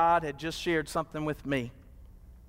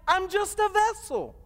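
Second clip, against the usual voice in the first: much higher, ringing preacher's cry on vessel; preached climax; 13 semitones higher than usual. Shown as a demonstration. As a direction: lift "I'm just a vessel" high and hold it.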